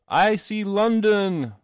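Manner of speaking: high, drawn-out slow cry; shriek. A narrator's reading. Man falling from the omnibus, shriek. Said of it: "With a shriek of".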